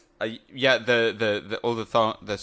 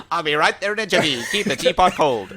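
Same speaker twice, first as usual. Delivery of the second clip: mock jaunty agent; 3 semitones higher than usual. Parody of Ethan's line.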